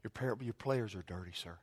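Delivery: soft, low, deferential remark; mock-polite. Preacher pretends he told the staffer politely, softly. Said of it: "I said it very politely".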